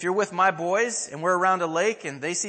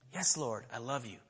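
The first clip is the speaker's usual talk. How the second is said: soft, lower answer fading away; humble reply; 6 semitones lower, 9 dB quieter than usual. Acted. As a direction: answer meekly, almost under your breath. Peter answers Jesus, humbled.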